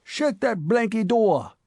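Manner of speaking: put-on old cabman sourly ordering the door shut. A narrator's reading. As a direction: snap at the intruder, slowly. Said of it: "said the mummy cabman sourly".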